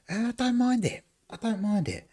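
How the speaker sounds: squeaky high put-on voice, light and playful; mock high voice. Answering chat about crisps in a squeaky high put-on voice. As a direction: pitch it way up as a silly character.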